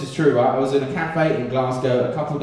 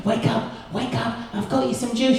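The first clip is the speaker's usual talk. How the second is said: put-on soft, high, coaxing boyfriend voice; gentle wake-up; 8 semitones higher, 4 dB quieter than usual. Boyfriend creeping in with juice for his girlfriend, gently coaxing. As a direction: wake her softly, pitched high.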